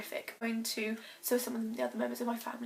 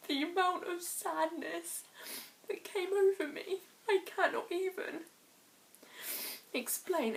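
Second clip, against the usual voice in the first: higher, slow, voice close to breaking with grief; emotional, near tears; 5 semitones higher, slower than usual. Real, not acted. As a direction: hold back tears while describing the loss. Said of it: "I'm sorry for getting emotional".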